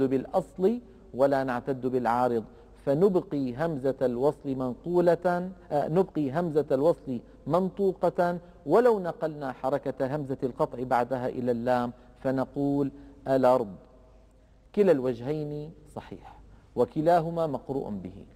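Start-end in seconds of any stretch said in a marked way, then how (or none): none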